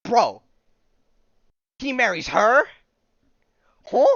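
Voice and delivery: loud, high disbelief; incredulous shout. Real, not acted. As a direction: yell it like you can't believe it. Shocked by the comic's twist.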